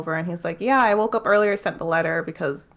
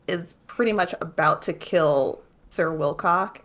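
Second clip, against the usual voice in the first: ordinary talk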